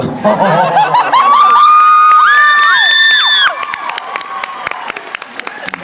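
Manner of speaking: shrieking high laugh; loud high cackle. Real, not acted. Laughs after limerick.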